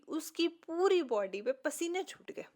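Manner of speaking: ordinary talk, nothing marked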